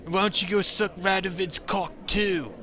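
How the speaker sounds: put-on high, slow, slurred drunk yelling; drunk man. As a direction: play a sloppy drunk jeering at the dwarf.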